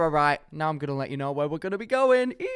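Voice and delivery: ordinary talk, nothing marked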